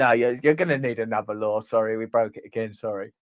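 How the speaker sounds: low, fading sheepish apology. Acted. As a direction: mumble a fake sorry. Agency apologising in skit.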